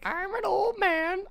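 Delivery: put-on high, slow croaky old man. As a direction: play a shaky old geezer. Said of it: "That was a terrible old man voice".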